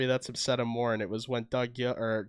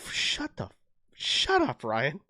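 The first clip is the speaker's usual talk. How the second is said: playful outcry; slower than usual. Real, not acted. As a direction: tease your friend to stop. Friend teasing, banter.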